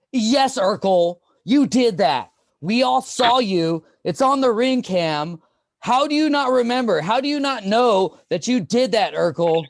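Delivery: loud, high, slow exasperated family member; Urkel's family scolding. Retold or imitated. Urkel's relative berating him, loud high exasperated voice. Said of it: "Urkel's family".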